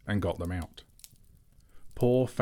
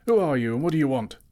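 loud, fast alarmed shout; startled guards yelling a challenge; 7 semitones higher, 7 dB louder, faster than usual. A narrator's reading. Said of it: "they shouted".

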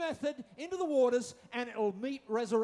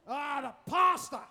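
slow, loud accusing cry; mock blaming shout; 2 semitones higher, 8 dB louder, slower than usual. Mock churchgoer blaming the pastor, a loud slow shout. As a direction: hurl the blame loudly and slowly.

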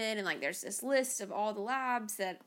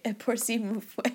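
foreign accent in French. Shown as a demonstration. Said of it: "trying to say".

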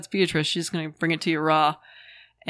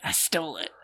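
whispered confession; 5 dB quieter than usual. Retold or imitated. Friend whispering the little brother's admission of theft. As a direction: breathe it out like a sneaky secret.

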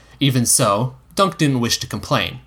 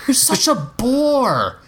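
put-on slow, higher whining boy; whiny boy; 13 semitones higher, much slower than usual. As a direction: drag it out like a bored kid. Egg complaining.

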